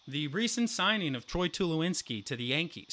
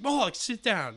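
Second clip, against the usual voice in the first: loud, higher stern call; coach order; 8 semitones higher, 4 dB louder than usual. Retold or imitated. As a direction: command him to sit. Coach orders him back.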